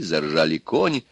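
ordinary delivery

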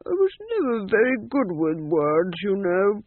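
put-on musing old ghost; affable old ghost king. A narrator's reading. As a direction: ramble genially as an old king. Voices old ghost Champot.

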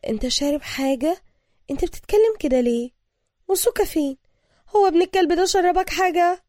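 high, loud, suspicious woman's questioning. A narrator's reading. Woman questioning a man who seems drugged, suspicious. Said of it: "ردت ابتسام بشك".

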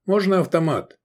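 high eager asking; young recruit. A narrator's reading. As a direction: ask like an eager young lad.

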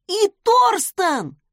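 high, loud, drawn-out astonishment; disbelieving gasp. A narrator's reading. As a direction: cry it out as if not believing your eyes. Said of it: "охнул Майкл, не веря глазам".

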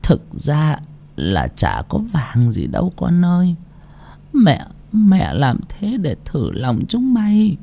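high, soft, slow confession; soft weary old voice; soft sad old mother. A narrator's reading. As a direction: gently reveal the truth, weary.

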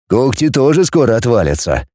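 plain reading voice

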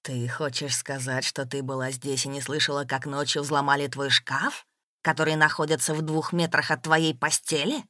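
menacing interrogation. A narrator's reading. Man doubts his beloved slept through it, menacing. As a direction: begin hushed and low, then press harder.

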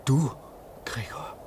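hushed unvoiced whisper; whispering so the others can't hear; whispered question. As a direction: breathe the name in secret.

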